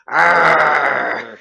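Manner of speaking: loud growling tiger roar. Acted. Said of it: "That's the worst tiger".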